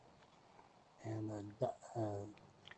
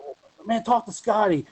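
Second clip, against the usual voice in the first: Drake passing phone; 8 semitones higher, 6 dB louder than usual. Retold or imitated. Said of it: "And then he said".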